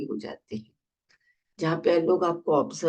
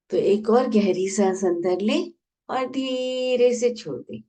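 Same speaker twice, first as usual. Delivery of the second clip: guided meditation. Acted. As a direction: ease them into each breath, stretching key words. Leads a guided breathing meditation.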